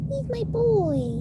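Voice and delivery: very high slow coo. Real, not acted. Doting on her pet, high slow coo.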